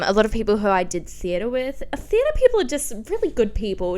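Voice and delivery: ordinary delivery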